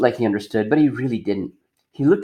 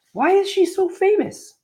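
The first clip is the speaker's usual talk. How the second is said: much higher puzzled small voice; Punchinello voice. Acted. Punchinello questioning the mayor's wife, high and puzzled. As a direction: ask like a curious little wooden boy.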